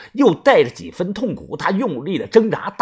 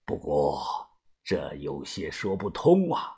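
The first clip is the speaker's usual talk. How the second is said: much lower, soft, grave boss voice; a frowning veteran weighing his words; deep, quiet and troubled leader; worried old boss; 13 semitones lower, 10 dB quieter than usual. A narrator's reading. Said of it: "把头眉头紧锁地说道".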